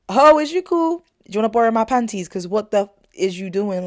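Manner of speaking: mocking comeback. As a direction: tease him like a sassy friend. Mock comeback to him.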